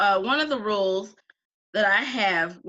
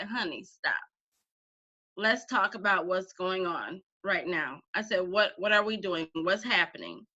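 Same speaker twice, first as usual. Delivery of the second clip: lower, softened voice of reassurance; gentle calming; 4 semitones lower, 4 dB quieter than usual. Retold or imitated. Teacher taking a frustrated student's hand, gently calming. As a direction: settle someone down tenderly.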